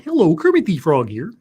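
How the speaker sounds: lilting puppet-frog greeting; lightly put-on Muppet voice, rising at the end; Kermit the Frog. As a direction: announce yourself as a cheery puppet frog. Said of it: "oh, and Kermit the Frog".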